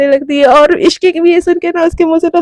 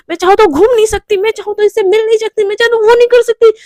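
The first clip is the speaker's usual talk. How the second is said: building rant; 6 semitones higher than usual. Acted. Romi rants at Mishka about her caged life, building up. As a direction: vent the frustration, climbing.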